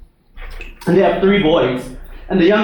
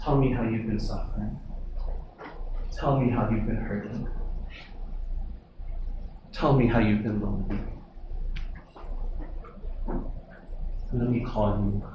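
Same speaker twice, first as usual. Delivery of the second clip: softer, lower, hushed ministering tone; gentle preaching; 4 semitones lower, 3 dB quieter than usual. Acted. Soft ministering passage, God's voice.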